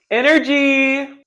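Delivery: rallying cry. Real, not acted. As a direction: drag out a hyped call. Rallying herself.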